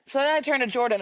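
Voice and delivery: usual speaking voice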